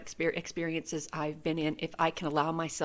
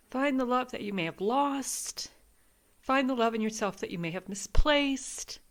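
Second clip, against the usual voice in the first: crying; 5 semitones higher than usual. Real, not acted. Reader crying while reading the card message to viewers. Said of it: "I'm crying".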